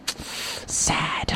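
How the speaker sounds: a mock-sad verdict, barely voiced; whispered. Host pronouncing the fans sad in a whisper.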